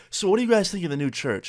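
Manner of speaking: a hint of chirpy reporter; news interviewer. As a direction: lightly imitate a TV interviewer. News lady interviewing churchgoers.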